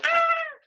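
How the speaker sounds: high scream; girl's high scream. Acted. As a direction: shriek as the wolf lunges. Girl screams as eaten.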